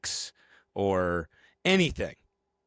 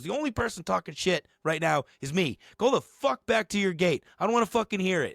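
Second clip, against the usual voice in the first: higher-pitched scolding order; mock telling-off; 8 semitones higher than usual. Woman in charge sending gate guy back, high mock scolding. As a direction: lay down the law as an irate boss.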